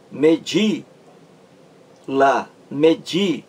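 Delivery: slow, spell out "medi" with the weight landing on "di"; stress on DI. Shown as a demonstration. Teacher to students, showing the stressed syllable, with "di" of "medi" punched. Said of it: "o acento mais forte está aqui nessa sílaba di".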